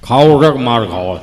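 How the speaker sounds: low, loud grandfatherly threat; threatening grandfather. Acted. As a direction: drop the pitch and threaten hard. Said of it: "દાદા ઊભા થઈને". (દાદાની ભારે ધમકી)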